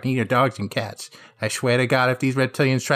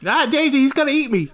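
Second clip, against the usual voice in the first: mock panic; 11 semitones higher than usual. Luigi panics about the Koopa.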